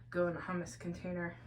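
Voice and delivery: normal talking voice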